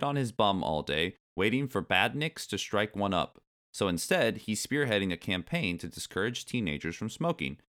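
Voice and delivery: animated article reading, punching the key words; lively magazine read-aloud. Acted. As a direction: read it out like a cheeky kids' magazine.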